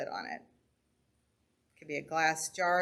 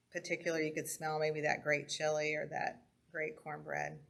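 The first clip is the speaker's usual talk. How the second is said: calm grounding exercise. Acted. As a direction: guide the breathing gently with pauses. Leads a breathing and senses exercise.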